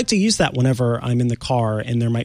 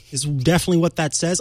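usual speaking voice

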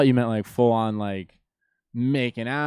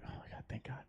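retold breath of thanks; whispered relief; 2 semitones higher, 20 dB quieter, faster than usual. Driver given a warning by the cop, whispered retold relief. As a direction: whisper it as if just spared.

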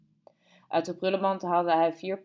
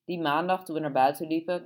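usual speaking voice

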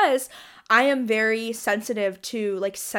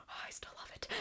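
very soft, voiceless gush; whispered aside; 23 dB quieter, faster than usual. Real, not acted. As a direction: whisper it to yourself with delight. Private gushing aside.

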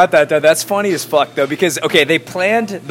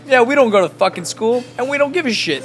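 higher, mock-cocky Miami jock bravado; 8 semitones higher than usual. A friend imitating careless Hurricanes players, cocky. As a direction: toss it off like a careless party jock. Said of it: "dudes with gold around their necks being like".

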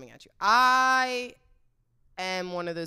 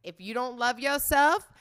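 hammed-up show sign-off, rising into the amen; mock-sermon rallying call; 5 semitones higher, 5 dB louder than usual. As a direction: preach it playfully, lifting to a higher, louder amen. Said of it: "you know what they say?".

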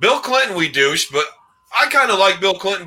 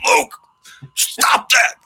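angry whisper; 10 semitones higher, slower than usual. Retold or imitated. Angry father scolding during movie.

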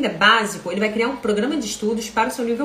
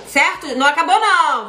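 exclamação alta; 6 semitones higher, 5 dB louder than usual. Real, not acted. Chama o público, não acabou.

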